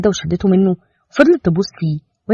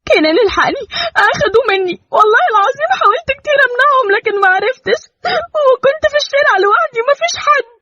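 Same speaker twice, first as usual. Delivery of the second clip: much higher, tearful panicked wife; frightened crying. Acted. Mother bursting into the police station in tearful panic. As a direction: sob it out in panic. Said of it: "وقالت بخوف".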